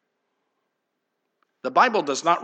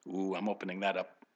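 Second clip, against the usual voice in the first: hushed aside; 8 semitones lower, 12 dB quieter than usual. Real, not acted. Aside about opening a topic.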